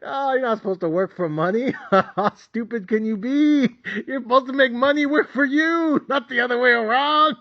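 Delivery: high, laughing ridicule; smug mockery. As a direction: smile and laugh down at them. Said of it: "I'll be looking at them smiling like".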